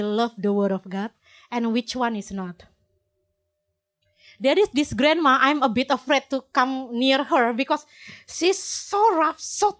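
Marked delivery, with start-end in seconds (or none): none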